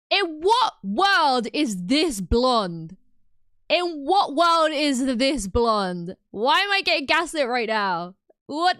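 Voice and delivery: slow, loud, stretched-out disbelief; indignant disbelief. Real, not acted. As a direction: drag each word out, offended. Outraged at chat gaslighting.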